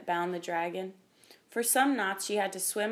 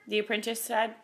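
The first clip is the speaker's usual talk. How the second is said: plain reading voice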